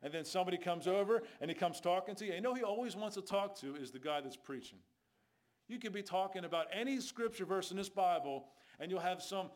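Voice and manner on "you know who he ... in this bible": ordinary talk, nothing marked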